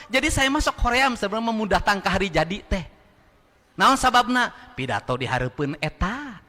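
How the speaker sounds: ordinary delivery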